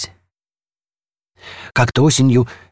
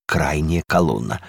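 ordinary delivery

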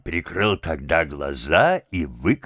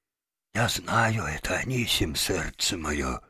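very soft, hushed murmur; whispering; 2 semitones lower, 7 dB quieter than usual. A narrator's reading. Father coaxes the janitor at night, whispering. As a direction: coax him almost in a whisper. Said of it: "прошептал папаша ещё тише".

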